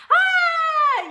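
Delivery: high, loud joyful cheer; room's joyful shout. Retold or imitated. Delivery room erupting as the baby cries, joyful shout. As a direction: cry out like the whole room at once.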